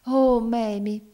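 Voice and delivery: storybook lover's ecstatic outburst; sudden ecstasy. A narrator's reading. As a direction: cry out in sudden delight. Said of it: "esclamò a un tratto con estasi".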